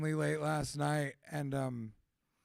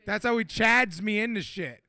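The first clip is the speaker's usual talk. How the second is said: ordinary delivery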